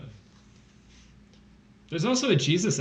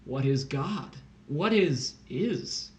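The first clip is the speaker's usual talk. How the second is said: very slow, swooping questions; drawn-out, pseudo-profound pondering; mock-philosophical musing; much slower than usual. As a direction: ponder each word with exaggerated wonder. Mock deep questions posed after silence.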